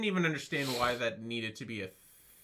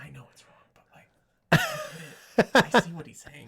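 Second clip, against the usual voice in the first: breathy whisper into a laugh; whispered mock juror; 4 semitones higher, 8 dB louder, slower than usual. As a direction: whisper it, then crack up. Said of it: "in that voice".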